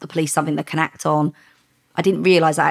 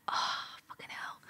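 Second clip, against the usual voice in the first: slow, voiceless curse under the breath; acted hushed "caught out" swearing; whispered dread; 19 dB quieter than usual. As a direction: whisper it as your heart sinks. Retold dread at being chased.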